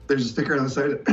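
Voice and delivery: ordinary delivery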